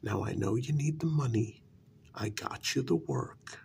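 ordinary talk, nothing marked

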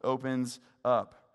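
loud, high preached reading of the psalm; preaching scripture. Acted. Preacher reads the psalm to his church, proclaiming loudly.

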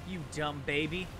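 ordinary delivery

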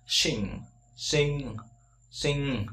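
"sing" with a short i and a nasal ng. Shown as a demonstration. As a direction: clip the vowel in "sing". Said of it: "y ngắn này".

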